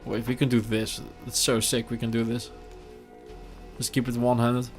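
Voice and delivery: ordinary talk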